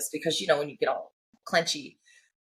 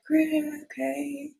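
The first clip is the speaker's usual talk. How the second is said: high, drawn-out mock okay; mock reluctant agreement; 8 semitones higher, much slower than usual. Friends reacting to her seizure instructions, mock reluctant. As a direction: stretch it out high and doubtful.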